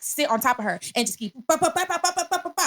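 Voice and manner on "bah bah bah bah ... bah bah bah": high-pitched volley of "bah" hits; rapid mock punches. Teller sitting on her rival in imagination, rapid mock punch sounds. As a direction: rattle off playful punch noises.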